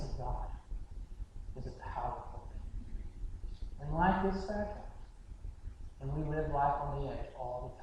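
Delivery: very soft, low voice with long pauses; hushed solemn preaching. Real, not acted. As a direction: let each phrase settle quietly, almost to nothing. Hushed, solemn close of the story.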